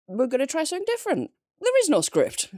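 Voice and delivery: high, fast excited announcement. Real, not acted.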